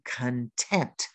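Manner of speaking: "content" with the stress on its second syllable. Shown as a demonstration. Teacher showing learners the word for happy, "content" stressed on the second syllable. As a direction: say "content" carefully as con-TENT. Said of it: "emphasize the second syllable".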